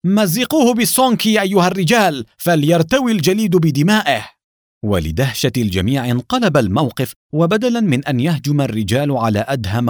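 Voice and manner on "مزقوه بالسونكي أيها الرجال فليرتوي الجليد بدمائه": high, forceful angry command; angry shout. A narrator's reading. Ivan ordering his men to tear the enemy apart, angry shout. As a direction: order the men to attack, enraged. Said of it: "صاح إيفان بغضب".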